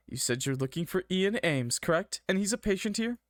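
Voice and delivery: a hint of an officious desk clerk; lightly voiced receptionist, impatient. A narrator's reading. Receptionist checking a visitor's details, lightly voiced and questioning.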